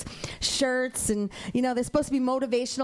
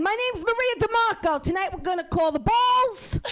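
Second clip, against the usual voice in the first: put-on New York accent; 5 semitones higher than usual. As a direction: call the numbers like a pushy New York gal. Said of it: "I wanna see a real New Yorker call the numbers".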